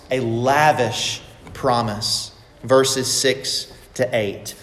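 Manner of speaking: slowly, leaning on "lavish"; slow emphatic repeat. Acted. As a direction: let "lavish promise" land, each word drawn out. Repeats the point slowly for weight.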